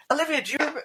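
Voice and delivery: high, swooping host voice, cut short; Graham Norton. Acted. Host addresses his guest Olivia, high presenter voice. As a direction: slip into a chat show host's voice. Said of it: "I nearly did the voice then".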